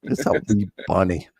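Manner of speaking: shaky try at British, played for a joke; attempted British accent. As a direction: echo the quote in a badly faked British accent. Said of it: "do a British accent".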